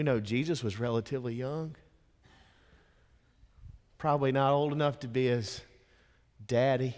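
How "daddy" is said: ordinary delivery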